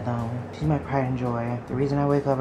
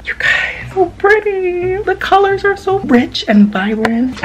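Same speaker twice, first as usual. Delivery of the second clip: very high, loud delighted gushing; gushing squeal; 12 dB louder than usual. Real, not acted. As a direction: swoon over the camera's colours out loud. Gushing over the new camera.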